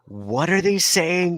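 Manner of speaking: high, drawn-out exasperated question; straining to hear. Retold or imitated. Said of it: "be like".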